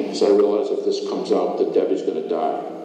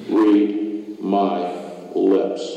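word by word, with deliberate gaps; real slowly; much slower than usual. Acted. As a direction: spell it out slowly for someone not getting it. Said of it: "I'm gonna say this real slowly".

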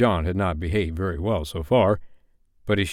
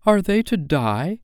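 contemptuous Wendy; 11 semitones higher than usual. Acted. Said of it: "look of such frightful contempt".

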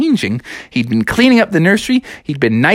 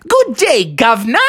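high, cheery put-on English accent; mock Cockney greeting; 6 semitones higher than usual. Froggy greeting passersby, high mock English accent. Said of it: "tip his cap to them and say".

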